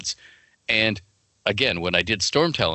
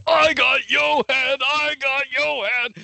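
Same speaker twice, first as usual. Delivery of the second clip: excited shout; slower than usual. Retold or imitated. Friend in the outback shouting about his rare card, high excited yell. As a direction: shout it like you just pulled the rarest card.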